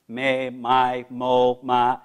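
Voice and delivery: me my mo ma hummed from the chest; resonant vowels. Shown as a demonstration. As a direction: sound "Me, my, mo, ma" buzzing on the sternum. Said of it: "feel the vibration of the vowels".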